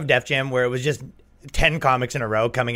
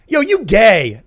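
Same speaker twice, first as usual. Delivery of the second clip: higher and louder heckler's shout; retold heckle yell; 8 semitones higher, 8 dB louder, much slower than usual. Heckler in the crowd yelling at the comic, loud and high. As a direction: bark it from the back of the crowd.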